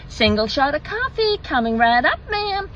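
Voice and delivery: eager American server calling back an order; mock American drive-through chirp; put-on American accent. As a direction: imitate a high, sing-song American barista. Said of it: "forgive the accent".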